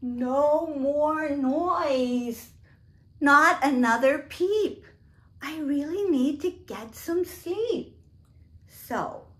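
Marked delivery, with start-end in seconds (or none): 0.0-8.0 exasperated queen scolding ducks; fed-up queen. Acted. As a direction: lay down the law as the queen.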